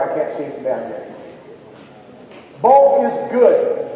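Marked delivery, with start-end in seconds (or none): 2.6-3.9 slow, deliberate definition; slow teaching. Shown as a demonstration. Performer teaching the crowd a word, slow and deliberate. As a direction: spell out the meaning slowly. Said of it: "teacher defining a word".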